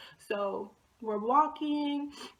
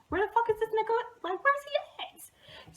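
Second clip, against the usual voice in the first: mock-anxious, high-pitched impatient complaint; 11 semitones higher than usual. Watching the window for a late crush, anxious. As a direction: voice climbing high as the wait drags on. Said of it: "when you're anxious, like, every minute".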